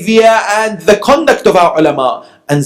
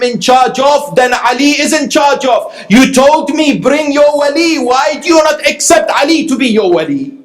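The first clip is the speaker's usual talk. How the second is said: fervent preaching; 8 semitones higher than usual. Acted. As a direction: drive the point home from the pulpit. Preacher building to fervent climax.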